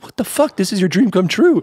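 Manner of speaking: high-pitched incredulous mock outburst; outer self. Outer me scolding inner me, incredulous high voice. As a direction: protest in a high, disbelieving voice. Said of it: "outer me was like".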